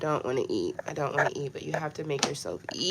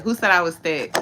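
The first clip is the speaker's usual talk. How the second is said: toned down a notch; 3 semitones higher, 10 dB louder than usual. Real, not acted. Calmer retry asking viewers who called her thick. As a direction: still loud but reined in. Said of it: "oh, I got too excited".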